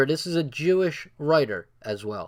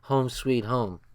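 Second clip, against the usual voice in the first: relieved sigh; 4 semitones lower, slower than usual. Retold or imitated. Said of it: "I was like".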